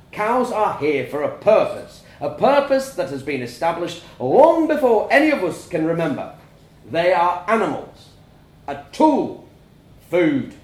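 a father preaching tradition to his family; stern farmer father lecturing. A narrator's reading.

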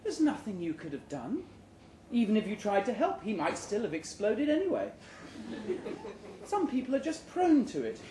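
a wife gently comforting her husband; consoling wife. Acted. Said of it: "She reaches across ... pats my hand".

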